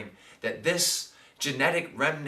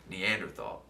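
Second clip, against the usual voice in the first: low, soft voice in his head; inner voice; 8 semitones lower, 7 dB quieter than usual. Retold or imitated. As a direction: murmur it like a voice inside your head. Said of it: "this voice pops up in my head".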